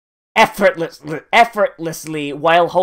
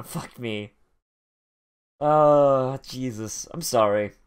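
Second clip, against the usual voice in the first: ordinary delivery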